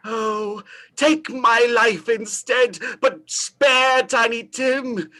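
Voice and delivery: slow, drawn-out begging; slow pleading. Acted. Scrooge slowly begging the spirit to spare Tiny Tim. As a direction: stretch each word out as you plead.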